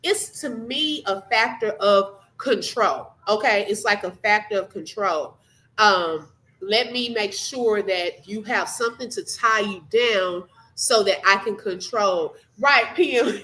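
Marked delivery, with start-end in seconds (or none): none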